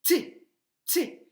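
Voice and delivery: "T" punched high and loud, twice; "T" loud and high, with a gap between; isolated first syllable. Shown as a demonstration. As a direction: hit each "T" as its own sharp beat. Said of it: "T, c'est la première syllabe".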